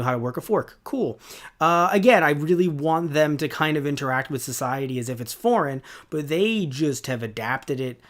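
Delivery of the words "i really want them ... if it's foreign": ordinary talk, nothing marked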